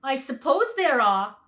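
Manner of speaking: the lion, easy and sure; lion's voice. A narrator's reading. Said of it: "returned the lion".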